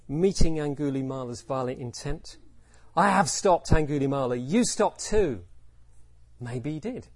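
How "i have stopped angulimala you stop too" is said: loud, forceful command; fierce. Shown as a demonstration. Buddha meeting Angulimala's violent intent, fierce command. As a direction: meet violent intent head-on, loud and firm. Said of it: "Did he say it fiercely".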